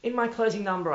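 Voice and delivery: usual speaking voice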